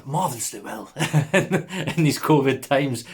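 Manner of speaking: put-on fast, high Warren accent greeting; a quick burst of Warren accent; playful Warren accent hello to viewers. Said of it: "Sorry for the Warren accent there".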